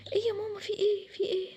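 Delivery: high, plaintive waking protest; woken-up whine. Acted. Daughter woken by her mother, plaintive.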